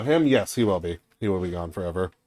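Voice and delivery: usual speaking voice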